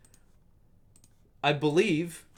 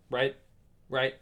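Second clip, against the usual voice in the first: usual speaking voice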